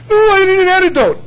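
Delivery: high, loud hammed-up plea; a snakebite victim begging in mock panic.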